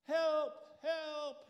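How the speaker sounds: high, loud cry for help; a man hanging from a cliff yells for rescue; yelling for help. Acted. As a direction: shout it up the cliff face, desperate. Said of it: "he's yelling".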